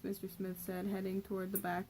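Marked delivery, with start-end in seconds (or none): none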